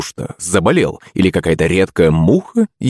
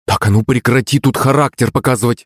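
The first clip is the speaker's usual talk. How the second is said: firm maternal reprimand, pitch raised; stern scolding; 5 semitones higher than usual. A narrator's reading. Mother scolding her stubborn son, stern. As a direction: cut the child's protest off firmly. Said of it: "строго ответила Клавдия".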